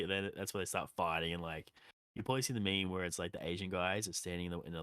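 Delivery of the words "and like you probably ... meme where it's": normal talking voice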